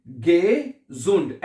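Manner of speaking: "gesund" split slowly as ge, zund; voiced z in the middle of "gesund"; teacher showing "gesund" in two parts, with a z sound. Shown as a demonstration. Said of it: "एस को हम जेड की आवाज में pronounce".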